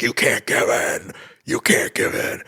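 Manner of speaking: strained, high scream held down in volume; mock scream. Imitating Dent screaming after Batman, strained mock scream. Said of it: "he's, like, screaming".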